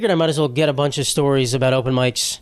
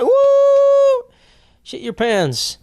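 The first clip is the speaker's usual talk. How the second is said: high whooping yell, then the words drop back; cheering on the performer from the audience; crowd cheer; 4 dB louder, much slower than usual. Retold or imitated. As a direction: whoop it up like a rowdy heckler.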